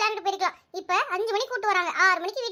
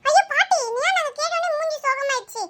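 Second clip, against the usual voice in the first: put-on worried child; 5 semitones higher, 3 dB louder than usual. Child worried about grandmother.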